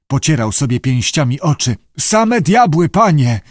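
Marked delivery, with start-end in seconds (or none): 2.0-3.4 high, frightened cry; panicked diver's lament; moaning in terror. A narrator's reading. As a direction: wail it at the master, pitched up. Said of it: "zajęczał Syngales".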